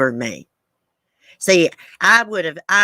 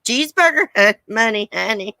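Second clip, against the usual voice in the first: raised-pitch catchphrase impression, broken by a laugh; Miss Effin' Wonderful; 6 semitones higher than usual. Streamer playfully imitating an online personality's catchphrase, high and laughing. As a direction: mimic an online personality while cracking up. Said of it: "Be like Miss Effin' Wonderful".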